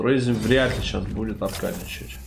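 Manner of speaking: usual speaking voice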